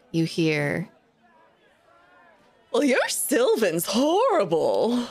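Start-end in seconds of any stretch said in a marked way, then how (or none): 2.7-5.1 haughty unicorn. Acted. Unicorn voice in his head.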